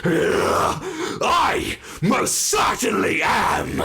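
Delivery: very slow, high, loud declaration; triumphant shout. Acted. Villain boasting to the human about her strength. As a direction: drag out each word in a gloating roar.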